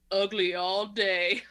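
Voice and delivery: drawn-out imitation of a movie quip; slow mock tough guy. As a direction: say it slowly, like a corny action hero. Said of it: "And he goes".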